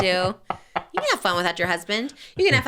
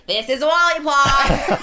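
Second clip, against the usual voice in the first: drawn-out, higher parody ad read; mock ad read; 5 semitones higher, 4 dB louder, much slower than usual. Co-host argues about an ad read, exaggerated slow ad voice. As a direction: stretch out the sponsor name mockingly. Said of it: "she's doing an ad read".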